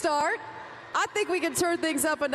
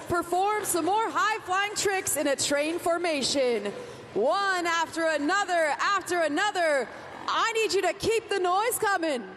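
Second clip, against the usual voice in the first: arena hype host; 2 semitones higher than usual. Real, not acted. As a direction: pump up the stadium crowd. Arena host hyping the crowd.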